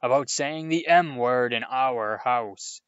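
loud, slow furious uncle; thundering. A narrator's reading. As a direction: thunder it over the table. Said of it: "thundered his uncle".

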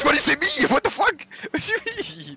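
high, laughing what do you mean; laughing disbelief. Real, not acted. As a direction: laugh through the question. Laughing at confusing game moment.

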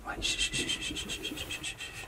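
whispered shushing, fading away; urgent hushing. Acted. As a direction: shush very softly so nobody hears. Hushing himself as van stops.

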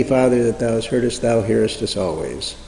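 ordinary talk

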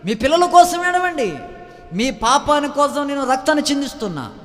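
high, loud pulpit cry; rising sermon fervour, loud and high; fiery preaching. Acted. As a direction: preach it hard to the congregation. Preaching at full force.

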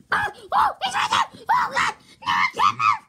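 frantic high-pitched screaming; panicking gamer. Real, not acted. In-game chaser right there.

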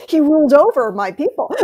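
chuckling as she jokes about her ancestor; laughing. Real, not acted. Laughing to her cousin about the prince who ruled her people.

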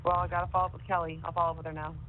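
ordinary talk

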